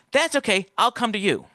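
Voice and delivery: a hint of a high eager woman; light mock date voice. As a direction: chirp it back brightly in a lightly voiced impression. Imitating date's eager reply.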